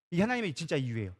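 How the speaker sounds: normal talking voice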